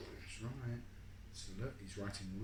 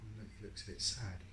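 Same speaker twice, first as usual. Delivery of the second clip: usual speaking voice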